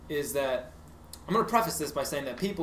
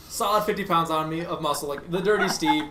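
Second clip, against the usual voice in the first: ordinary talk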